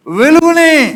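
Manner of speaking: high, loud cry of వెలుగుని; triumphant shout. Real, not acted. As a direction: declare వెలుగుని as a shouted victory. Answers own question triumphantly. (ఉత్సాహంతో గట్టిగా అరుపు)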